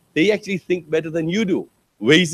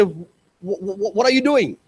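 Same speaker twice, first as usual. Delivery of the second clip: stammering disbelief; 3 semitones higher than usual. Retold or imitated. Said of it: "So I look at the student and say".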